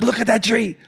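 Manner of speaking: high-pitched urgent order; insistent command. Retold or imitated. Boyfriend ordering his brothers yet again, high insistent cry. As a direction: cry out to make them all look.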